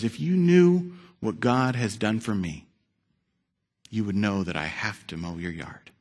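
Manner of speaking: steady and gentle, won't be shaken; quietly firm old neighbour; calm old man. Retold or imitated.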